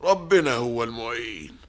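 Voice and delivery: ordinary talk, nothing marked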